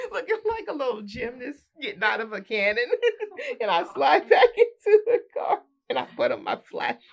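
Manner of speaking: real laughter; laughing. Real, not acted. Laughing through the story.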